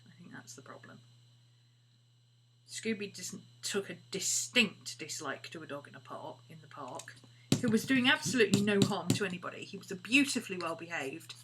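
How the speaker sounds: usual speaking voice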